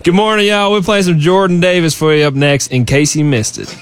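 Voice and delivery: high-pitched American radio host accent. Shown as a demonstration. Australian singer introducing [name] on request, American accent. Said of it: "give me that American accent one more time".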